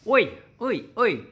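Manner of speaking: loud high oi at a waiter; loud high demanding oi; rude call. Retold or imitated. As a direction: hail the waiter rudely. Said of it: "don't go up to waiters and go".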